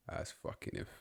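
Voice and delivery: very low, hushed scoff; muttered disbelief. Acted. Acting insulted at being called a Millwall fan, hushed scoff. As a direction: scoff almost inaudibly under your breath. Acted scoffing in staged skit.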